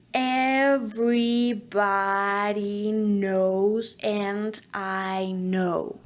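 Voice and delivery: very slow, drawn-out vowels; slow motion. Shown as a demonstration. Coach demonstrating the slow-motion diction exercise. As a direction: stretch it out like a slowed tape. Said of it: "Like if we were in slow motion".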